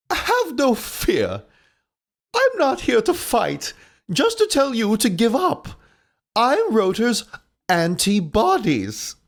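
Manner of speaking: put-on high, slow old lady; squeaky granny walrus. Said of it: "this is old lady walrus voice".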